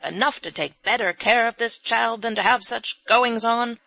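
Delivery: loud scolding, puffing up the stairs; furious scolding. A narrator's reading. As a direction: scold everyone at each step. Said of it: "scolding furiously".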